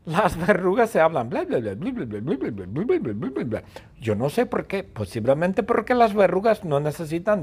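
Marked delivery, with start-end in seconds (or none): 1.3-3.6 chattering warts. Retold or imitated. Warts gabbing while Stewie sleeps, sing-song blah blah. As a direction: mimic endless chatter, bouncing up and down.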